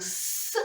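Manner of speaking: «с» as a soft hiss; voiceless s. Shown as a demonstration. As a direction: hiss the «с» quietly. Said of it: "а звук". (тихий глухий звук «с»)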